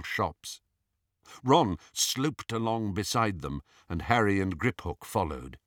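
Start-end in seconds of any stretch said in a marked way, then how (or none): none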